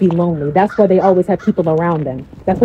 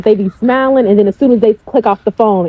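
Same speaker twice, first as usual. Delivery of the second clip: ordinary talk, nothing marked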